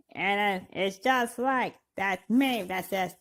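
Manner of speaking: usual speaking voice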